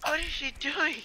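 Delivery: high frightened disbelief; panicked. Real, not acted. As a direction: squeal as she eats you. Panic while eaten.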